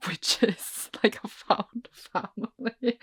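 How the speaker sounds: slow, laughing through the words. Real, not acted. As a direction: drag it out while giggling. Laughing at own bent logic.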